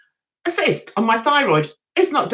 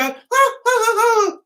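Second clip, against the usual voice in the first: high-pitched coy little oohs; hammed-up bashful gasps, held high; mock coy "ah"; 10 semitones higher than usual. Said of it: "be all coy and go".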